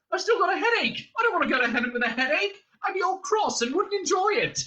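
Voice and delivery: very high, agitated wail of complaint; Arthur yelling. A narrator's reading. Prisoner complaining about dying with a headache, yelling. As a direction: protest frantically, voice way up. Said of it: "he yelled".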